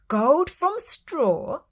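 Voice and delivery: greedy king crying out. Acted. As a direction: savour the thought greedily. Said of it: "the king cried greedily".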